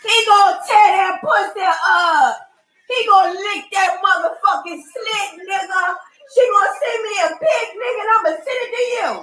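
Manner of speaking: laughing while shouting. Real, not acted. Laughing while yelling insults.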